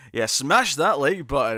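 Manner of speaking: loud, drawn-out promo hype; mock the big presenter sell; over-enthusiastic presenter. Streamer mimicking cringey self-promoters, enthusiastic presenter voice. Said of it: "be really enthusiastic and presentary".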